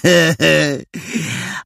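wysoki, szyderczy rechot; drwiący śmiech. A narrator's reading. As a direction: zarechocz szyderczo. Adaś się śmieje. (szyderczy rechot Adasia)

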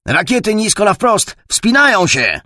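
high, loud, urgent shout; shouted warning. Acted. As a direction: yell the missile sighting to the squadron. Pilot shouts missile warning over radio.

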